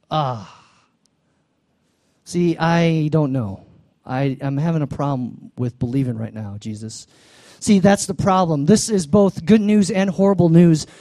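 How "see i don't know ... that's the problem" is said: ordinary talk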